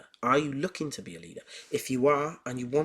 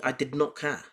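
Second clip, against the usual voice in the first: ordinary talk, nothing marked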